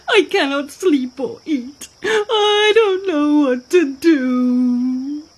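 loud, high, drawn-out wailing; crying hippo. A narrator's reading. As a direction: bawl slowly with toothache, hammed up for a story. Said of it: "and started to cry".

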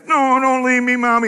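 put-on high, whiny child's plea; clinging child. Preacher imitating a child clinging to mommy, high and whiny. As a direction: beg not to be left, like a small kid.

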